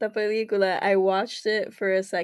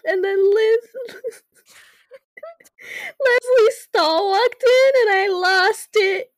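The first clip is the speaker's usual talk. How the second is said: tearful laugh, slow and louder; laughing through tears; 13 semitones higher, 8 dB louder, slower than usual. Real, not acted. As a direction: laugh while choking up. Retelling crying at the screening.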